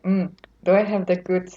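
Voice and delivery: ordinary talk, nothing marked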